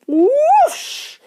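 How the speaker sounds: very high, loud whoosh; swooping high sound effect; whoosh noise. A narrator's reading. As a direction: imitate something shooting up a pipe. Narrator imitates shooting up pipe.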